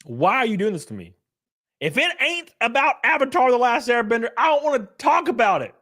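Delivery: ordinary delivery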